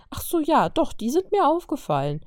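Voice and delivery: sarcastic fake discovery, brother teasing his sister; exaggerated sarcasm, high and loud. A narrator's reading. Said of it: "sagte er sarkastisch".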